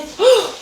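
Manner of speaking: sharp, high gasp of fright; mock terrified gasp; imitated scared gasp. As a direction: mimic grandma catching her breath in alarm. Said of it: "cause she was scared".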